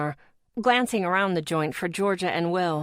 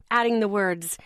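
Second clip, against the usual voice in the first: ordinary delivery, nothing marked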